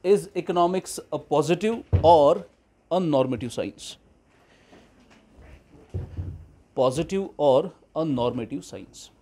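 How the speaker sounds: very slow, every word spaced; slow dictation. Shown as a demonstration. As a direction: read the question out for copying. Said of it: "dictating the question slowly". (धीमे-धीमे सवाल लिखवाना)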